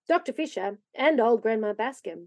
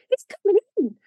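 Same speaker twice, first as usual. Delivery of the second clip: put-on high, loud child's cry; excited cry; 9 semitones higher, 5 dB louder than usual. A narrator's reading. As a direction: call it out, thrilled. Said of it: "cried Davy in chorus".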